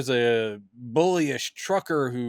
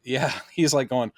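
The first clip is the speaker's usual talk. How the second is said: ordinary talk, nothing marked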